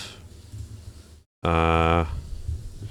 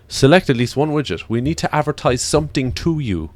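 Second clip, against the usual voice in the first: louder, higher sneering corporate voice; mocking Google voice; 5 semitones higher, 4 dB louder than usual. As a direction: parody the pushy browser. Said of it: "I was like".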